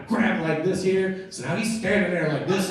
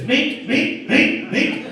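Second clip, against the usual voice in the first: imitated stomping noises; 9 semitones higher, 5 dB louder, much slower than usual. Two men stomping him on the ground, squeaked impact effects. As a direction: run of high squeaks.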